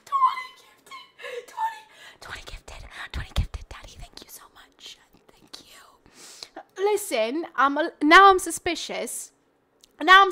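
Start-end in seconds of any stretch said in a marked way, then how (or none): 0.1-1.1 very high, loud, drawn-out squeal; real excited shriek; ecstatic shriek. Real, not acted. Reacting to twenty gifted subs. 1.5-5.8 voiceless, fading whisper; awed whispering after the screams; overwhelmed whisper. Real, not acted.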